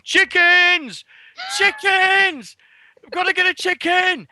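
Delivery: "Chickens!" shouted high and loud, again and again; retold shout. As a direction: holler it down the aisles like an excited kid.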